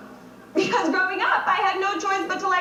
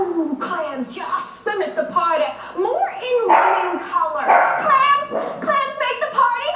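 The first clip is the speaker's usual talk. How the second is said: escalating caricature; 2 semitones higher than usual. Acted. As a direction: ham it up further with each phrase.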